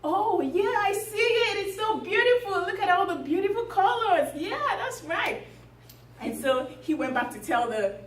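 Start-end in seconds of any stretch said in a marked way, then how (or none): 0.0-5.5 high-pitched feigned delight; pretended admiration. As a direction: gush as if you can really see it. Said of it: "he now pretended as if he could actually see it".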